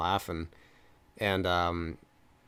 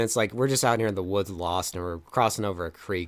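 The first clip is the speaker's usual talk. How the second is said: usual speaking voice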